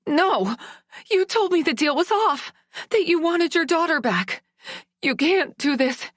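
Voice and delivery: high, loud, frantic screech. Acted. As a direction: scream it at him, voice climbing. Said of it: "I screeched".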